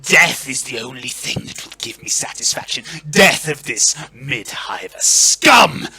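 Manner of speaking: rasping, seething lord; venomous anger. Acted. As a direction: build to a loud, contemptuous finish. Verence rages for a death fight.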